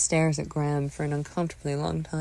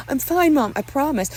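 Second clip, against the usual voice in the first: high, raised-voice reassurance; insistent protest; 11 semitones higher, 6 dB louder than usual. Acted. Son reassures mother through door, raised insistent voice.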